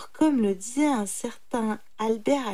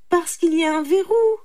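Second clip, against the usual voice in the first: high, loud explanation; reply firmly with raised pitch; brahman retorting; 8 semitones higher, 7 dB louder, faster than usual. A narrator's reading. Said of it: "répliqua le brahman". (réplique forte et aiguë)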